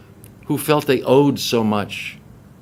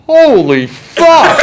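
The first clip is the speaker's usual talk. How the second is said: high, loud, drawn-out shout; amazed heckler's outburst; astonished yell; 13 semitones higher, 9 dB louder, much slower than usual. Retold or imitated. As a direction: holler it in stunned disbelief. Stunned crowd reaction after pause.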